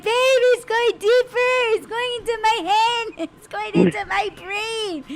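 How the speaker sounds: high, panicky pleading, nearly crying; retold panic. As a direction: beg him, frightened and laughing. Retelling panicked ear scare.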